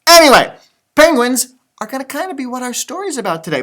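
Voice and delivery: usual speaking voice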